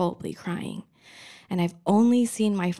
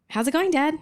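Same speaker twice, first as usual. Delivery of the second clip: chirpy small talk; 7 semitones higher, 4 dB louder, faster than usual. Retold or imitated. Trying to make conversation with dad.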